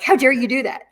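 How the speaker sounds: acted-out indignant reproach; retold indignation. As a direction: scold her as if freshly betrayed. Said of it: "I was so mad at her".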